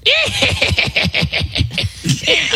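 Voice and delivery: high maniacal cackle; mad scientist laugh. Pretend. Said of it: "Is that a mad scientist?".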